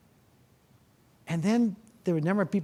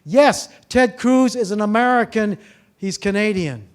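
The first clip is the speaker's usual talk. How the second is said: much louder, slow, higher mock agreement; mock reply to a politician, loud and slow; sarcastic retort; 5 semitones higher, 9 dB louder than usual. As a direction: hammer it home with sarcasm. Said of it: "I wanted to say to Donald Trump".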